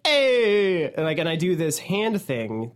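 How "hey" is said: loud Italian-American "Hey!"; Italian accent. Pretend. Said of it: "my normal Italian voice is like".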